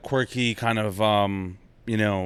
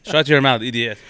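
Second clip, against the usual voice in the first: put-on loud, insulting Persian-accented character; Persian realtor; 6 dB louder than usual. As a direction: shut them down in a Persian accent. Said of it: "the Persian realtor".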